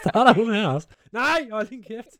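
high voice breaking into laughter; laughing disbelief. Real, not acted. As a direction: protest through real, helpless laughter. Laughing as the assistant starts up. (grinende og vantro)

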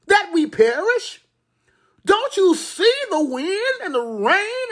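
desperate disciples crying out to their master; scolding plea. Acted. Frightened disciples scolding the sleeping Jesus, desperate. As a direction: cry it out as if the boat is sinking. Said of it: "they scolded the Lord".

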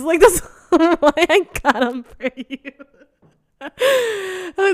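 high, loud, breaking into laughter; laughing through words. Real, not acted. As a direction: crack up while delivering the punchline. Cracking up at own story.

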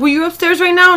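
alarmed question. Retold or imitated. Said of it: "She was like".

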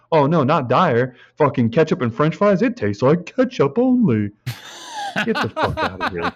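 mock outrage, pitch climbing. Mocking reaction, raised voice.

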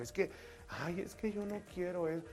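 put-on a bashful girl pulling away from her boyfriend; shy girl voice.

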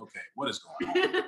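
high, laughing what-is-going-on; laughing disbelief. Retold or imitated. To friends, about neighbors being pilots, laughing disbelief. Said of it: "and I'm like".

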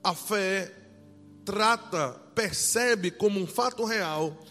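fervent, loud preaching. Acted. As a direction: preach it with full force. Fervent scripture reading and preaching.

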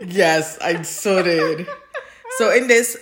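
slow, laughing through the words. Real, not acted. Admitting to her co-host she lost it, laughing. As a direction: still giggling, barely holding it. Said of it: "I could picture you losing it".

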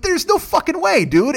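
high, scoffing disbelief; incredulous. Retold or imitated. Answering Gio about Dig Dug viewers, incredulous and high. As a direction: squeak out total disbelief.

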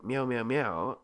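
imitated string of meows; repeated cat meows. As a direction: pester like a hungry-sounding cat. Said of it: "He'd be like".

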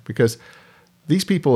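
ordinary delivery

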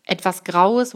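plain reading voice

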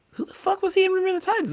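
very high, fast disbelieving squeal; high-pitched stunned squeak; shocked squeal. Retold or imitated. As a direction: shriek it in disbelief. Disbelief at Ryan Hurst role.

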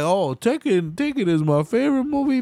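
high, fast mocking voice; high, fast mock of the woman; mock airhead. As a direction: squeak it out like a ditzy fake.

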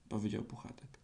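plain reading voice

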